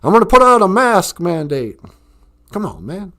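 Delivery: put-on Southern-accented politician parody; Biden impression. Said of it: "I can only do the Southern accent".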